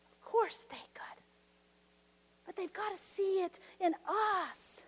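hushed, voice breaking with emotion; tearful whisper. Real, not acted. Preacher fighting back tears as he pleads with church. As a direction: barely hold back tears.

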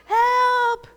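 yelling for help from the water; drowning man's yell. Retold or imitated. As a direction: call out like someone going under. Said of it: "they're yelling".

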